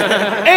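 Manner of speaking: bursts out laughing; a loud real laugh; quick loud laugh. Real, not acted. Laughs at own claim.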